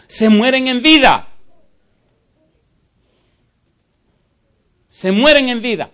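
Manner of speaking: high, loud preaching cry; fiery preaching shout; impassioned shout. Real, not acted. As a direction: cry it out over the crowd. Preacher's climax, repeated line.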